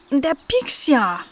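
high, drawn-out whine of protest; pained complaint. Acted. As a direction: complain slowly and high about the prick. Cleo complains after being pricked. (hohes, gedehntes Jammern)

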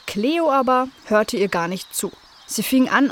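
ordinary delivery, nothing marked

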